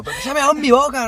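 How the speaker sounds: high, fast mock complaint; indignant child's protest. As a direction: protest in a squeaky rush, imitating yourself as a kid. Quoted complaint to mother, repeated. (queja aguda y atropellada, imitándose)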